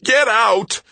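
furious high-pitched shout; very loud, enraged yell; screamed. A narrator's reading. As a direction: scream the order at an intruder. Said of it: "Greg screamed at him".

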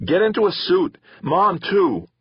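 high, loud urgent shout; frantic shouted order; yelling. A narrator's reading. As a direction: keep yelling orders at his sister. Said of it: "he yelled at her".

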